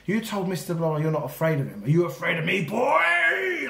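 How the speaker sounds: headmaster shouting. Retold or imitated. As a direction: roar it into his face as a bullying headmaster. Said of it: "he's shouting in my face".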